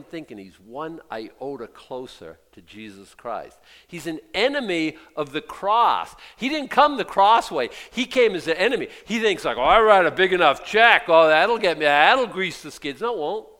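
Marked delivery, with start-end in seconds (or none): 3.9-13.5 loud, high, fired-up preaching; fiery preaching. Acted. Pastor refusing a million-dollar check, fiery preaching. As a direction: refuse the money with full fire.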